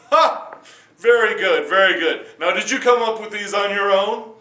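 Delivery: loud, high burst of delight; a king thrilled with the answer. Acted.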